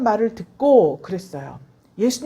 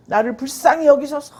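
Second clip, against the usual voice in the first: a louder retold cry, hit 불쌍히 hard; 4 semitones higher, 6 dB louder than usual. The beggar cries louder to Jesus despite the crowd, pleading. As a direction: call out for mercy with more force, stressing 불쌍히. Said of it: "외칩니다".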